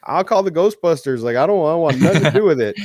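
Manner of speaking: high, fast, hammed-up no-thanks; mock panicked refusal. Joking with his dad about haunting, a high fast mock refusal. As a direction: rattle it off high and quick like you want no part of ghosts. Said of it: "I'm like".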